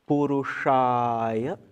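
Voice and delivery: "Purushaya" very slow, long-held A after the SH; retroflex S, long vowel. Shown as a demonstration. Teacher showing students the mantra word, "Purushaya" with retroflex SH and long A. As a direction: say "Purushaya" with tongue rolled up on SH, A stretched. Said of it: "see the dot under the S and the long".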